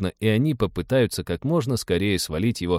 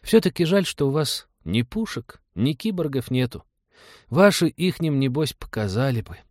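put-on high-pitched, regretful child voice; disappointed little boy; 6 semitones higher than usual. A narrator's reading. Boy regrets the ship has no cyborgs, high disappointed voice. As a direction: lament like a small boy. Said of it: "с огорчением заметил".

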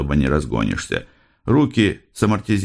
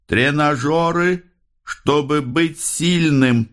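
high, slow chant-like cry; raving detective; 10 semitones higher, slower than usual. A narrator's reading. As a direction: proclaim it like a man possessed, stretched out. Raving detective repeats his cryptic words. (высоко, протяжно, как одержимый)

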